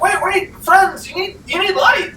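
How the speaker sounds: high-pitched anxious calling; Cornelius. Acted. Villager chasing friends with a light, high urgent calling. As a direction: call after them in a high voice. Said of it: "he's huffing and puffing towards you".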